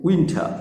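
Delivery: উইন্টার said as "win-tha"; breath on the t of উইন্টার; slightly aspirated t. Shown as a demonstration. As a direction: puff the t in উইন্টার, drop the r. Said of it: "ঠর মতো আনবে".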